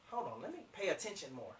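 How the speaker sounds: hushed, low remark to oneself; prospect's inner aside. Acted. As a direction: murmur it softly as attention sharpens. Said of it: "now that prospect is gonna say".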